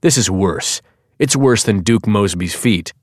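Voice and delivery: plain reading voice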